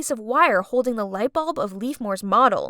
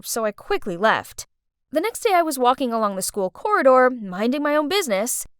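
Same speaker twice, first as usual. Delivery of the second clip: ordinary delivery, nothing marked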